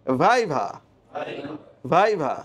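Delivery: ভাইভা with English V in both syllables; correct viva pronunciation. Shown as a demonstration. Teacher modelling a commonly mispronounced word, careful V demo. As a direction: model ভাইভা, upper teeth on lip twice. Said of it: "ভাইভা উচ্চারণটা ভুল করে".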